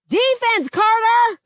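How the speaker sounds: high, loud yell across the court; coach yelling. A narrator's reading. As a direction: bark the name from the sideline. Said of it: "Coach yells".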